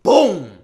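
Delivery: shouted impact noise, falling; loud boom sound. Retold or imitated. Bursting from cover, a shouted boom. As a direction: blast out a BOOM like a hit. Sprinting out of hiding.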